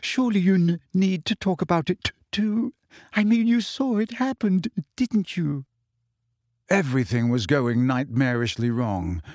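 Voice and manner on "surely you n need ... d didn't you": higher, crying voice stumbling on un-, un-; hurt, pleading through tears; high tearful voice breaking on the stammers. A narrator's reading. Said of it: "tears spattering down onto the table".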